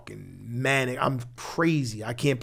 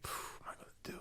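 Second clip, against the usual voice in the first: unvoiced despairing whisper; whisper to himself; 24 dB quieter, faster than usual. Looking at his daughter on the visit, whispering to himself. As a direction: breathe it out like a private thought. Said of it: "I'm saying to myself".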